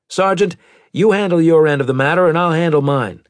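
stern captain voice. Acted. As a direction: voice the captain with authority. Maddox rebukes sergeant.

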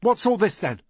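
high, loud stern challenge; stern boy calling everyone to account. A narrator's reading. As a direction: demand it sternly, pitched well up. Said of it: "Peter said sternly".

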